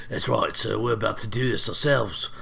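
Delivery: put-on gruff American take on a New Zealand accent; Dee Bradley Baker. Host showing he can match the clone actor, gruff impression. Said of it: "I think I can do it as well as he can".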